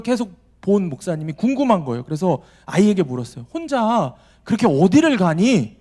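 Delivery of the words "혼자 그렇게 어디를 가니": curious pastor. Retold or imitated. Pastor questions child on train.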